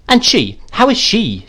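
high, sharp questioning. A narrator's reading. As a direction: cut in and demand an answer. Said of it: "said my aunt sharply".

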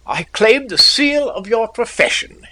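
mock-formal, high and bright. A narrator's reading. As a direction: lay on a mock bow. Mock obeisance, character speaks.

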